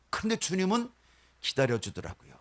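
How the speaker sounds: usual speaking voice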